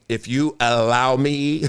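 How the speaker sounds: slow, low mock-polite line; SpongeBob quote. Quoting SpongeBob to a coach, slow and low. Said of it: "what they say on SpongeBob".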